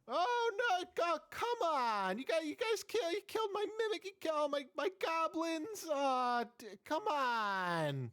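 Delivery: high, whiny little demon pleading; squeaky imp complaining, high-pitched. Acted.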